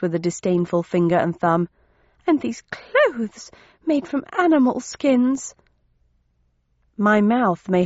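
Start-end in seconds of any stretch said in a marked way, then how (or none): 2.3-5.5 high, slow, pained complaint; unhappy distaste. A narrator's reading. Thursday shedding leather apparel, unhappy and slowly dismayed. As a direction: draw it out unhappily, pitched high. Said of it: "she muttered unhappily".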